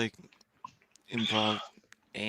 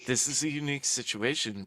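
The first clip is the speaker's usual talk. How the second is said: calling out; 4 semitones higher, 14 dB louder than usual. Real, not acted. Calling to a chat moderator.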